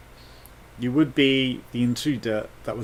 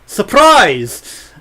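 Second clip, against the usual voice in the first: loud surprise; 8 semitones higher, 12 dB louder than usual. Acted. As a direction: shout surprise. Jump scare joke.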